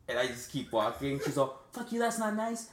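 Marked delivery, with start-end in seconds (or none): 1.7-2.7 very high mimicked jeer; high-pitched mock-angry parroting; mocking hooker. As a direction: throw the words back as a jeering hooker. Hooker throws his words back.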